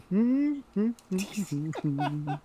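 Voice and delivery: high, loud hum; tuneful wordless humming; carefree humming. Acted. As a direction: hum obliviously while strolling along. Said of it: "he's just humming".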